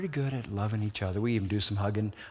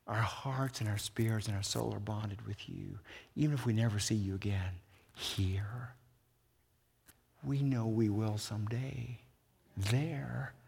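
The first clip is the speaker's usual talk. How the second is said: much softer, tender blessing; tender farewell; 3 semitones lower, 9 dB quieter than usual. Acted. Friends part from Paul at the dock, soft and tender. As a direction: speak it gently as a last goodbye.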